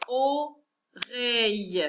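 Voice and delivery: Oreille slowly as o, pause, reille; split into syllables. Shown as a demonstration. Teacher counting syllables for pupils, Oreille split up slowly. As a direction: sound out Oreille in two separate parts. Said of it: "On va compter ensemble les syllabes".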